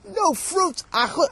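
very high, loud indignant cry; student exclaiming at the story, very high and loud; outburst. Real, not acted. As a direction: burst out in shock.

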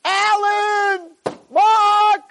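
names hollered high and drawn out; acted high-pitched shouting; mock scream. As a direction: yell for friends as if in pain. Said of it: "they're not screaming in their pain".

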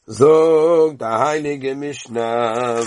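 a long singsong prompt to start reading; slow drawn-out call. Shown as a demonstration. Teacher calling students to recite, very slow and drawn out. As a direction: stretch every word out, very slow, leaning on Zog.

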